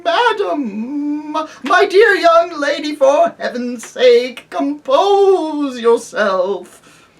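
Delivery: high, loud, quavering panic; trembling scream. Acted. Uncle Andrew begging the witch, a trembling scream. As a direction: plead in terror, voice cracking. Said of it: "in a sort of trembling scream".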